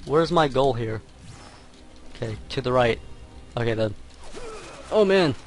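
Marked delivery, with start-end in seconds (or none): 4.9-5.4 flustered rush. Real, not acted. Panics over missed path.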